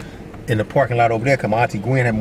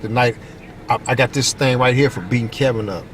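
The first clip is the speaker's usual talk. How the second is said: ordinary talk, nothing marked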